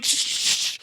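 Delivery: voiceless repeated hissing bursts; rapid hissed stab effects; imitated stabbing noise. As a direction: make slashing sounds with the breath.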